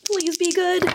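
high, hopeful plea to the dice; pleading. Real, not acted. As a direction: beg the dice. Pleading before the dice roll.

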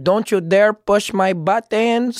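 put-on Filipino accent. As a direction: draw out the threat like a stern parent. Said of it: "Oh, I can hear it".

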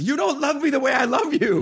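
whiny lover. Acted. Sappy couple refusing to hang up.

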